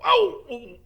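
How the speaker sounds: very high loud yelp; pained yelp. Acted. Hagrid bitten again, very high yelp. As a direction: cry out as it bites.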